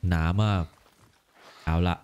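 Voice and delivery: low, grave father voice; dubbing the father, low and heavy; stern warrior father. Acted. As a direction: speak as a hardened warrior father.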